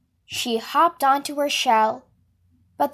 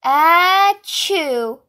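elephant sneeze; 4 semitones higher, 6 dB louder than usual. Acted.

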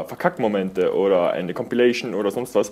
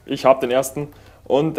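normal talking voice

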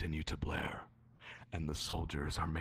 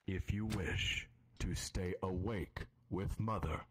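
game master narration. A narrator's reading. GM offers a choice.

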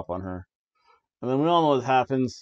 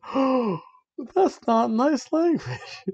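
much higher, slow prim scold; prissy scolding; 12 semitones higher, much slower than usual. Retold or imitated. Alexa Bliss scolds Sister Abigail, high and prissy. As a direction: clutch pearls at the bad word. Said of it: "Alexa Bliss said".

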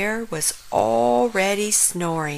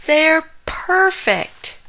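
high, slow, awestruck; gasped wonder; 9 semitones higher, much slower than usual. A narrator's reading. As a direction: draw it out slowly in high amazement. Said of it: "gasped Frog".

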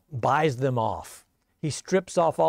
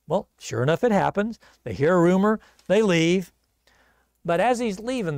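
normal talking voice

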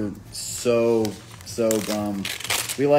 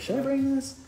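high, small mock voice asking herself; inner question; 3 dB quieter than usual. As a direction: voice her own doubt, pitched way up. Recalling her own thought.

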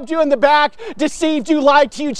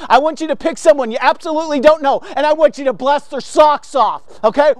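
ordinary talk

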